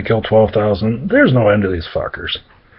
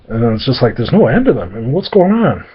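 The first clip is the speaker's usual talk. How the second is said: usual speaking voice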